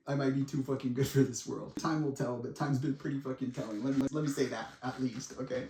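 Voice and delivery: ordinary talk, nothing marked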